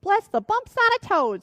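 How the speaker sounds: put-on old witch crying out in alarm; shrieking old witch. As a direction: shriek it like a startled hag. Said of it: "shrieked the old witch".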